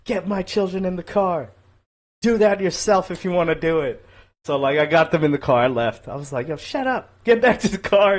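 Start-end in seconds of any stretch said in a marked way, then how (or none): 0.1-4.0 imitated yelling. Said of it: "like, yelling". 6.5-8.2 high-pitched, held-back retort; urgent scolding. Retold or imitated. Retold reply to yelling wife.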